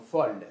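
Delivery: "folle" with an open O; open-mid O in "folle". Shown as a demonstration. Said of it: "Ça, c'est un O ouvert".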